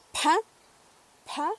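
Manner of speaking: pa with a strong, exaggerated puff of air; aspirated p. Shown as a demonstration. Teacher exaggerating the puff of an aspirated pa. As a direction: breathe out hard after the p of pa. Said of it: "soltar el aire un poquito más fuerte".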